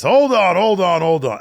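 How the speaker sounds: interrupting like a wrestling promo; mock shout. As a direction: cut in loudly, wrestler style.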